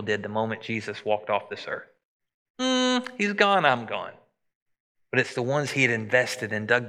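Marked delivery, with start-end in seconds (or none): 2.6-4.1 high, slow, careless hum then shrug; dismissive shrug. Retold or imitated. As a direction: toss it off like you don't care. Voicing people who walk away.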